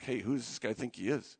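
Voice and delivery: low, hushed crowd grumble; acted-out muttering under the breath; retold muttering. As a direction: mutter it softly, as if from the back. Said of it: "People are muttering in the back".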